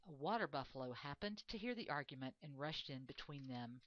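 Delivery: ordinary delivery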